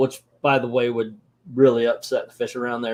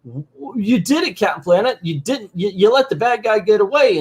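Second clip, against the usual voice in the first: higher-pitched mock complaint; protesting kids; 7 semitones higher than usual. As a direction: whine at the hero like cartoon kids. Kids protest to their hero.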